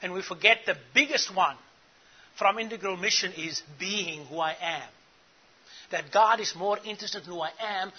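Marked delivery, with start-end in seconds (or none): none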